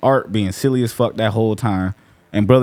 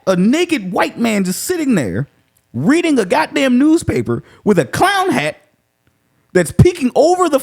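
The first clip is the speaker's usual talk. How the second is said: high incredulous rant; 13 semitones higher than usual. Real, not acted. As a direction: stretch it out in high disbelief. Incredulous rant about film.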